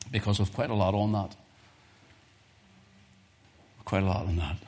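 normal talking voice

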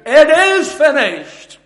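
loud, high preacher's cry; cried out. Acted. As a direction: proclaim it at full voice, as Christ's cry from the cross. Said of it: "you'll hear him cry for you".

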